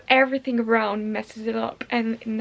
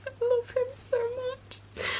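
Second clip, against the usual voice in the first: high, soft tearful squeak; tearful breakdown; 12 semitones higher, 6 dB quieter than usual. Real, not acted. Reader professing love for Caleb, tearful. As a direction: squeak it softly, overcome with love. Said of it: "my breakdown".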